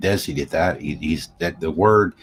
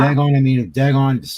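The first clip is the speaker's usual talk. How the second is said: exasperated exclaim; 6 semitones higher than usual. Acted. Exclaiming in mock frustration.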